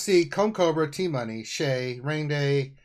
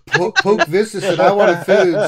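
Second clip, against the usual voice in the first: laughing; 5 semitones higher, 8 dB louder, slower than usual. Real, not acted. Laughing while retelling friend.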